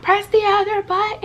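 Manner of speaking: high, loud pleading imitation; frantic mom voice. Mom begging the toddler through the window, high and frantic. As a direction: mimic mom urging the baby frantically. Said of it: "My mom's still like".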